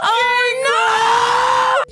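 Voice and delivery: high, crying oh no; mock horror. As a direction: wail in fake dismay. Watching Jax's prank unfold.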